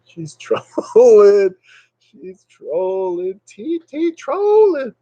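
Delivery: high, drawn-out squeal; mock accusation. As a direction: stretch it slowly into a silly high-pitched wail. Mock alarmed thought, drawn out.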